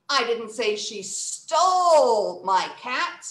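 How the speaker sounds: STOLE loud and drawn out; slow, with a big loud "stole"; stress on STOLE. Shown as a demonstration. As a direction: punch and stretch "stole". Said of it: "let's try another one".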